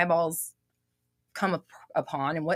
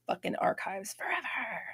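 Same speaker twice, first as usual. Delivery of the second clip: whispered aside; 3 semitones higher, 9 dB quieter than usual. Real, not acted. Aside while pinning comment.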